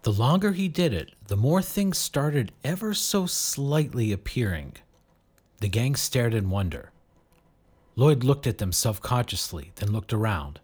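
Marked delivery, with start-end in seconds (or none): none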